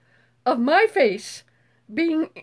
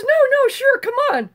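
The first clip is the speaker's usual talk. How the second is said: coaxing man; 9 semitones higher, 4 dB louder than usual. Retold or imitated. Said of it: "He goes".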